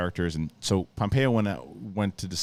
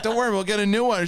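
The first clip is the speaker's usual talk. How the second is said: mock soothing parent; 12 semitones higher, 3 dB louder, faster than usual. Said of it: "we're like".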